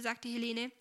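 usual speaking voice

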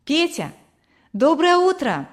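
high-pitched greeting called out; calling out. A narrator's reading. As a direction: call to a boy to catch his attention. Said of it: "Она окликнула его".

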